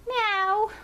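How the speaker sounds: high imitated meow, rising at the end; cat meow. The cat meowing again a minute later, a high imitated meow. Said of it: "I thought I heard the cat go".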